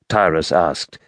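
usual speaking voice

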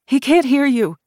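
put-on high, loud woman's plea for the boy; desperate cry. A narrator's reading. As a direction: cry out to make the gunman understand. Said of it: "the middle-aged woman cried".

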